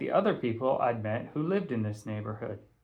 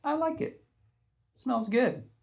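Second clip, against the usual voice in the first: Patty's voice; 12 semitones higher than usual. Acted. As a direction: say it sweet and light. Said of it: "Patty added".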